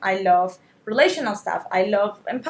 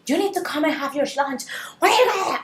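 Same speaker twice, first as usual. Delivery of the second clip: mock yell at the kids, pitched up; high, mildly shouted scolding; retold shouting; 6 semitones higher than usual. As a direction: act out a mother calling out in exasperation. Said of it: "I was shouting".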